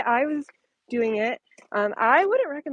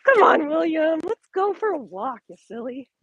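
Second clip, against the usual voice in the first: pet voice; 7 semitones higher, 4 dB louder than usual. Real, not acted. Talking sweetly to her dog.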